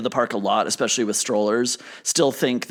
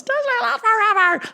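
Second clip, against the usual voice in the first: very high-pitched mock holler; top-of-lungs yell. Shown as a demonstration. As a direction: demonstrate a fan's shriek across a crowd. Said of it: "yell as top, at the top of your lungs".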